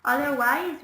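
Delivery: ordinary talk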